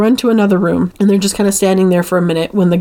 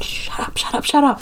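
hissed shut up, half voiceless; frantic hushed shushing; urgent whisper; 6 semitones higher, 6 dB quieter than usual. Retold or imitated. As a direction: whisper it frantically, don't get caught. Toy goes off while hiding.

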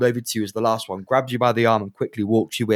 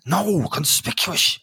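slow, high, deliberate correction; barman's voice; 7 semitones higher, much slower than usual. Acted. Barman corrects the party, slow and high. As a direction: spell it out slowly as the barman. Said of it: "he turns around to you and goes".

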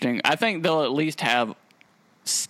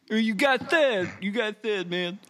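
much higher, slow mocking troll voice; Twitter troll voice; 9 semitones higher, slower than usual. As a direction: taunt like an internet troll, pitched high. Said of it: "my Twitter troll voice".

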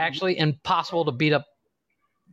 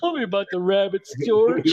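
Lennie impression; 3 semitones higher than usual. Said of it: "Lennie quote from Of Mice and Men".

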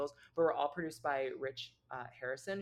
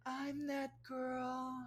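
slow, high sassy drawl; diva attitude; 10 semitones higher, 5 dB quieter, much slower than usual. Pretend. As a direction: own it like that girl. Diva mock line.

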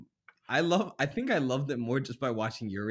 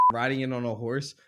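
usual speaking voice

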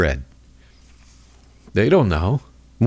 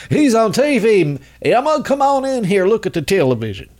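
excited TV-struck Midwesterner; 9 semitones higher than usual. Acted. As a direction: call the family over, voice pitched way up. Mimics awed Midwestern TV viewers.

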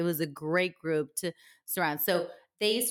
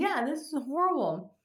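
hoarse voice; 7 semitones higher than usual. Real, not acted. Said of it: "my voice will be partially lost".

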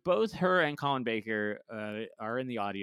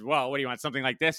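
louder, mock-exasperated sarcastic question; retold sarcastic challenge, pushed louder; 3 semitones higher, 7 dB louder, faster than usual. Costume designer to producer about a wild coat, sarcastic. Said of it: "sarcastically, the costume designer designed".

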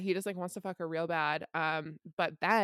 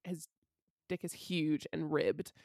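usual speaking voice

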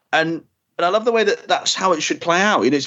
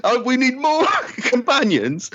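laughing shout; 8 semitones higher, slower than usual. Real, not acted.

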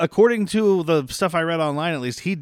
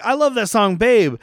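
excited fan; 6 semitones higher, 4 dB louder than usual. Retold or imitated. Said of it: "He's like".

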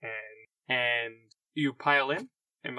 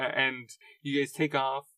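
usual speaking voice

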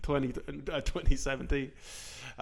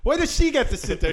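retold indignant argument; 5 semitones higher, 7 dB louder than usual. Retold drunken argument on bus.

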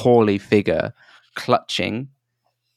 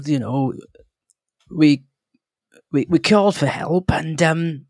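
a fretful halfling, pitched up; halfling, higher; 5 semitones higher than usual. Acted. Halfling telling the travellers how they fled, higher and jittery. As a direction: tell it like a jittery little prospector.

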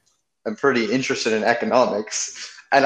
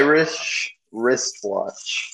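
"Irish wristwatch" drawn out slowly, word by word; slow, careful tongue twister; 4 dB quieter, much slower than usual. Shown as a demonstration. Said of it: "Say it faster".

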